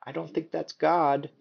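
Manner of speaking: lightly voiced mom, kind yet frank; kind but blunt. A narrator's reading. Mom gently setting her son straight, kind but blunt. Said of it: "kindly and with a characteristic bluntness".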